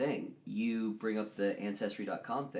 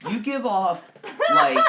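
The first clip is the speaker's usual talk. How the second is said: laughing shout; 13 dB louder, slower than usual. Real, not acted. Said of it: "laugh shout".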